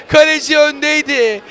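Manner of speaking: usual speaking voice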